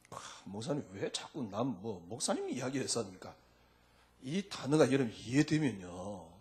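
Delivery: ordinary talk, nothing marked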